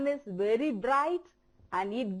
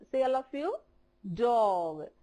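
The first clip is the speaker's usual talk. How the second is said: normal talking voice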